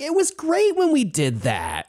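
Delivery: a wistful, high-pitched reminiscing; dreamy high voice. Retold or imitated. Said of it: "the errant was like".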